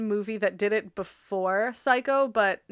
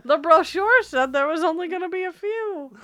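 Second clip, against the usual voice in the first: comic put-on voice; 8 semitones higher, 5 dB louder than usual. Comic bit voice.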